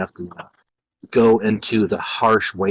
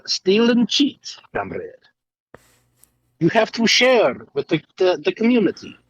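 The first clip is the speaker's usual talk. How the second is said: mock Russian accent; 7 semitones higher than usual. Teasing friends in a bit about Soviet Russia, hammy Russian accent. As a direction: lecture a comrade about sharing, in broken Russian English.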